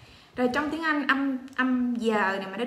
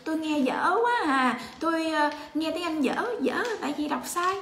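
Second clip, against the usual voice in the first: mock complaining learner; 5 semitones higher than usual. Teacher mimics learners lamenting their poor English, mock complaining. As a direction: whine about being bad at it. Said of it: "Chúng ta nói".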